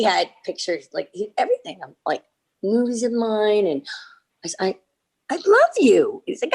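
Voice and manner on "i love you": higher-pitched retold declaration of love; excited mock declaration; gushing. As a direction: gush it out fondly. Retold gushing exchange with director.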